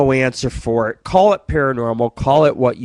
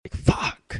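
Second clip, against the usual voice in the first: whispered swear; 7 dB quieter than usual. Retold or imitated. Swearing quietly near nuns.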